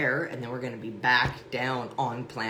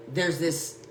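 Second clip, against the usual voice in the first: usual speaking voice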